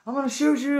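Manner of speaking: high, loud acted warning; high-pitched threatening shout; shouted police threat. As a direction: imitate a worked-up officer yelling. Officer threatening to shoot.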